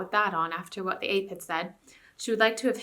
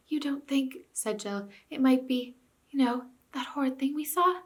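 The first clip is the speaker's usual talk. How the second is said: hushed fear; 5 semitones higher than usual. Acted. Jill frightened, hushed.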